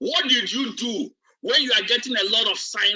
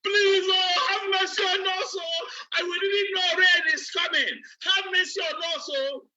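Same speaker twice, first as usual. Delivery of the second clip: crying and shouting; 9 semitones higher than usual. Retold or imitated. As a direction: cry out for mercy as the door shuts. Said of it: "started crying and shouting".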